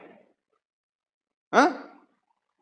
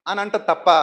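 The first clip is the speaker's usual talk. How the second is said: usual speaking voice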